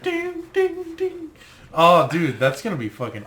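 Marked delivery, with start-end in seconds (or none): 0.0-1.3 very high, thin "Ding, ding, ding" like a meter; high beeping ding. Pretend. Mimics a ghost detector beeping.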